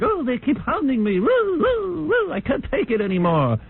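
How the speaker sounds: high, breathy-free mocking princess whine with woo woo; mock Lady Di wailing about photographers. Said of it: "Lady Di's like".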